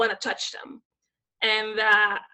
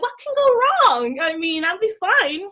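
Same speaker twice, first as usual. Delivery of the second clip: higher, louder, breezy naive boast; mock carefree confidence; 7 semitones higher, 7 dB louder than usual. Her younger self before Japan, mock breezy confidence. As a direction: play your past self sure nothing can fail.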